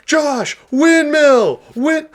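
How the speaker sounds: high, hollered cheer from the stands; retold scream. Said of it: "I would just scream at Josh".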